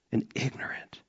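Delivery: whisper. Acted. Confessional psalm line, whispered.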